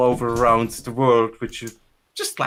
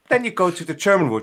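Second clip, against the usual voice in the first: usual speaking voice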